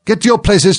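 very high, commanding yell; thunderous shout. A narrator's reading. Queen starts the game, shouting thunderously. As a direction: shout everyone into position. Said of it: "in a voice of thunder".

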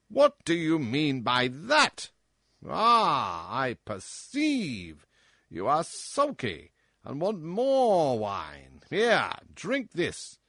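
loud, high, bullying king voice; roaring tyrant king. Acted. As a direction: boom like a spoiled drunken tyrant. King bullies the dwarf.

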